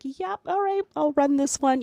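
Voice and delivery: casual, high and breezy; totally cool waiter. Retold or imitated. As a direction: toss it off like it's nothing. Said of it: "like, totally cool".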